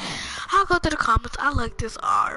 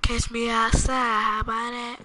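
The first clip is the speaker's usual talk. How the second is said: imitated catchphrase. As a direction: draw the catchphrase out lazily. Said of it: "he said".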